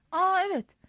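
high, caught-out "Aa"; a touch of panic, pitched high; mild panic. A narrator's reading. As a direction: answer in a flustered rise of pitch. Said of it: "dedim panik içinde".

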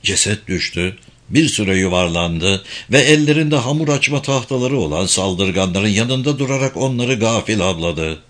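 plain reading voice

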